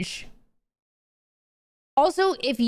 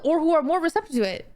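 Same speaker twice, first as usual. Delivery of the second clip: usual speaking voice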